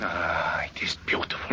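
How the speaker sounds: breathless whisper of wonder; awed whisper. Acted. Sees the stolen necklace.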